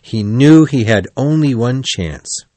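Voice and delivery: plain reading voice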